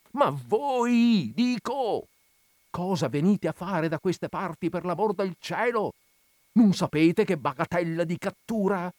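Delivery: put-on high, alarmed old curate, pressing; anxious old man scolding in disbelief; fretful old priest. A narrator's reading. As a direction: Don Abbondio frets at reckless Renzo.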